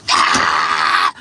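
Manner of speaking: loud low startled shout; startled scream. Retold or imitated. Recalling a scary photo popping up, loud startled scream. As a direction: scream like a jump scare. Scared by picture flashing up.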